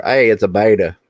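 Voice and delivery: Southern twang, beta as BAY-duh; mock Southern, casually announcing it; real Southern accent. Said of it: "you could get real Southern".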